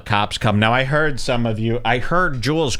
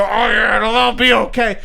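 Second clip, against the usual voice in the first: higher, louder mock soothing; 7 semitones higher, 4 dB louder than usual. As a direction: jeer in a fake comforting tone. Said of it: "he's mocking".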